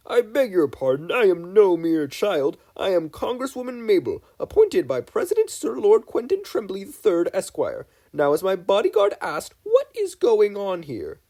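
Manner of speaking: hammed-up self-important official; pompous congresswoman. A narrator's reading. Mabel bluffing agents as pompous congresswoman, high and loud.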